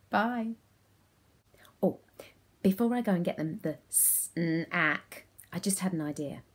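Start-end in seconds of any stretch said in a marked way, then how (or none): 3.9-5.3 SNACK split into s, n, ack; sounded out. Shown as a demonstration. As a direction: say SNACK in separate robot sounds. Word sounded out as in game.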